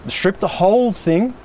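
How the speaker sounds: strip and whole thing pushed up high and drawn out slowly; emphatic frustration. Real, not acted. To the client, venting about the old wiring, high, slow emphasis. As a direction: hammer home what should have been done, slowly.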